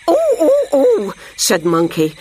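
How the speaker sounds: very high, loud ape whoops; excited monkey calls; hooting monkey. A narrator's reading. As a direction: hoot like an eager monkey. Narrator voices Monkey hooting eagerly.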